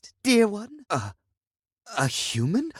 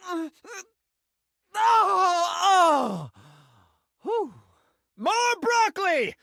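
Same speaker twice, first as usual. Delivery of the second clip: excited squeals; much slower than usual. Acted. Sink man's excited gibberish moments.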